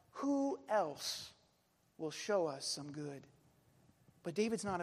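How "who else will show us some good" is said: mild lament, falling away; plaintive cry. Retold or imitated. People looking for someone else, plaintive cry. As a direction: start raised, let it sink. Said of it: "we cry".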